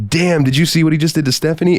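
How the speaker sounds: amazed friend. Retold or imitated. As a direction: disbelief at what he just saw. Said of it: "he turned to tell me".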